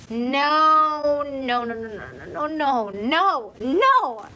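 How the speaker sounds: drawn-out mock wail, swelling at the ends; anguished denial. Reader whose worst fear comes true, anguished denial. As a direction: wail it theatrically, dipping then climbing loud.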